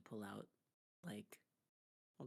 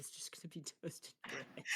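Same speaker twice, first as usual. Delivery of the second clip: whispering; 4 semitones higher, 4 dB quieter than usual. Real, not acted. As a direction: whisper it under your breath. Aside in a whisper.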